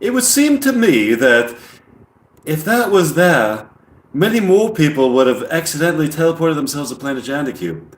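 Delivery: put-on formal English-accented alien, lifted pitch at the start; English accent, pondering aloud as an alien. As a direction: imitate a measured English alien. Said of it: "good English accent".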